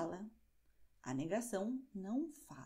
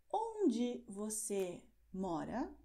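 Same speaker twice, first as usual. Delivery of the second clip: informal model question; much slower than usual. Shown as a demonstration. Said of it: "Vamos ver um exemplo".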